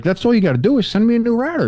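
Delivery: high, fast insistence; high fast fed-up demand; exasperated demand. Retold or imitated. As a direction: rattle it off, fed up. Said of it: "I was like".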